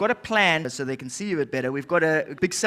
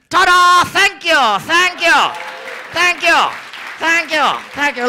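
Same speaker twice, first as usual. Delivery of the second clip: high loud showman thank-yous; triumphant ta-da; 10 semitones higher, 5 dB louder than usual. Acted. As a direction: take a big bow out loud. Puppet triumphant finish.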